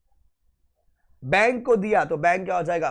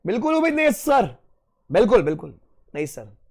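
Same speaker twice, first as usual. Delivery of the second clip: mock student chorus; 3 semitones higher, 4 dB louder, faster than usual. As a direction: shout it like the whole class. Students voiced, loud. (पूरी class की तरह ज़ोर से चिल्लाकर)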